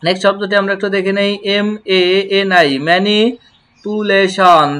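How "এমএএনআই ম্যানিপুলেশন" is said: ম্যানিপুলেশন spelled then said slowly as me-ni-pu-le-shon; slow, syllable-by-syllable ম্যানিপুলেশন; slow model word. Shown as a demonstration. Teacher to students, slow spelling and pronunciation. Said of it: "teacher models pronunciation".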